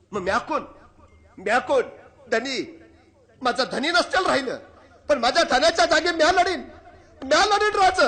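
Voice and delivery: loud, high, rising battle vow; a widow swearing to fight in her husband's place; defiant warrior widow. Acted. (लढण्याची शपथ घेणाऱ्या पत्नीचा उंच मोठा आवाज)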